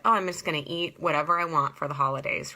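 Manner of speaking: ordinary talk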